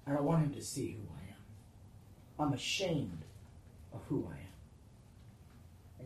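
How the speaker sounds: soft, low, hiding in shame; ashamed inner voice. Acted. The sinner recoiling from God, low and ashamed. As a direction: sink down to a low near-whisper of shame.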